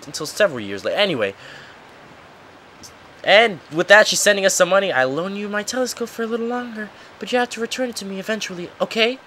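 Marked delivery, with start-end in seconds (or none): 4.9-9.2 put-on soft, high-pitched girl character; girl's letter. Girl writing to her brother, soft and high. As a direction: read the letter in a light, high, softened voice.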